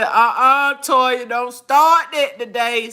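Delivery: loud, firm mock scolding; bossing her out of crying; scolding friend. Somebody scolding her not to cry.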